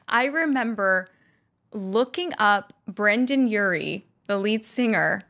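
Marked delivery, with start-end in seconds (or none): none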